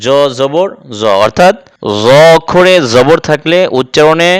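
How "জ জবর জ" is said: normal talking voice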